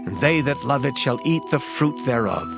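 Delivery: usual speaking voice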